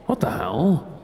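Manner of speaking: ordinary talk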